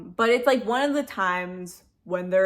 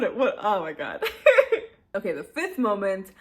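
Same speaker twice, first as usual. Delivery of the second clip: ordinary talk, nothing marked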